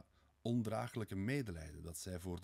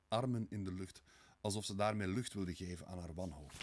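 ordinary delivery

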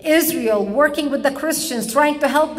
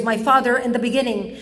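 ordinary talk, nothing marked

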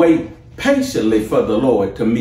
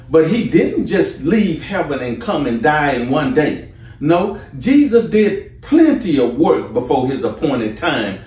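rising, fervent preaching, pitched higher; 4 semitones higher than usual. Acted. Preacher rousing the church about Jesus' works, fervent and high. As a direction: lift the congregation, building fire.

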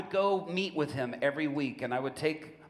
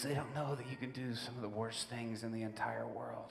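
quiet confession; 2 semitones lower, 8 dB quieter than usual. Retold or imitated. Prisoner confides quietly.

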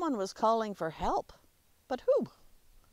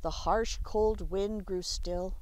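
usual speaking voice

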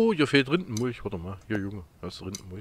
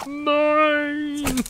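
high, loud mock-wife "Nein!"; imitating his wife; 5 dB louder than usual. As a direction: squeal it like his wife would. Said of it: "ich mach meine Frau nach". (Ehefrau nachgemacht, hoch und laut)

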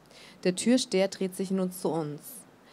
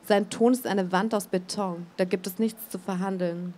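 usual speaking voice

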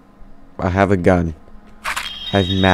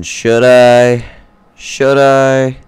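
raised, urgent asking; alarmed loud question; 5 semitones higher, 5 dB louder, much slower than usual. Real, not acted. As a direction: call it out in alarm. Panicked question, monster near.

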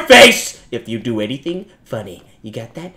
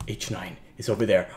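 ordinary talk, nothing marked

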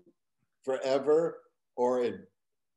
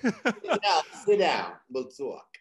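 put-on loud Queens accent invitation; New York accent; 5 semitones higher, 6 dB louder, slower than usual. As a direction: urge them to sit in broad New York talk. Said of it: "I was talking like this".

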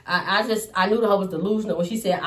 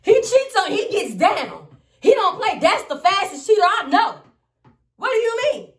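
much higher, louder, incredulous; high mock outrage; 10 semitones higher, 5 dB louder than usual. Mocking his mama's claim her son doesn't cheat, high and loud. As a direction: shriek it out in disbelief.

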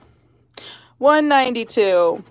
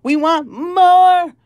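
higher, louder, slow whiny demand; greedy girl; 7 semitones higher, 6 dB louder, slower than usual. Pretend. Streamer mocking a spoiled schoolgirl who wants more, slow and whiny. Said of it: "I sound like this girl".

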